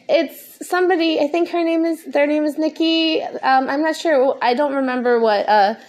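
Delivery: losing the thread while stoned. Real, not acted. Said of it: "Sorry, I'm so high".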